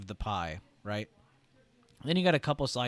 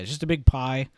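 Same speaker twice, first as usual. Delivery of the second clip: "it's just a big pie" said much louder; emphatic, louder; 3 semitones higher, 12 dB louder than usual. Shown as a demonstration. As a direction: punch "big pie" hard and loud. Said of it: "Like I said".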